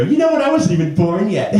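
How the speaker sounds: high-pitched mocking imitation; chirpy young interrupter. Youngster popping up in conversation, mock chirpy. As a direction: pipe up pertly, imitating a youngster. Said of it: "Some little chip will always pop up".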